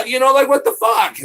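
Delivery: loud, high-pitched outrage; exasperated shout. Real, not acted. Streamer vents over being falsely accused, exasperated shout. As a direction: keep yelling after a frustrated scream. Shouting on after frustrated scream.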